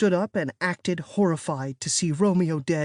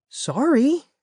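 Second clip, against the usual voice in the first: high, loud call; called after; 10 semitones higher, 4 dB louder than usual. A narrator's reading. Sibling calling sorry after a stomping sister, high and loud. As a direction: call it after someone leaving. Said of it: "I called after her".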